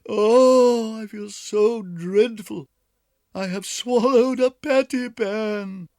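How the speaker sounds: moaning and whining. A narrator's reading. Duchess whining that she feels dreadful, moaning. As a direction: moan it out in misery. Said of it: "Duchess moaned and whined".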